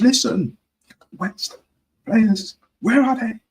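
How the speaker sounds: high-pitched agitated demand; high, urgent mock rant to camera; fan-cam rant. Imagined fan cam rant to viewers.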